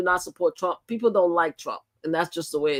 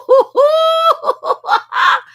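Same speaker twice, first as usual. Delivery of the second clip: shrieking laugh, much higher; a real, screaming laugh at the clip; loud burst of laughter; 9 dB louder than usual. Real, not acted. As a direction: burst out laughing at the top of your voice. Reacting to people screaming in clip.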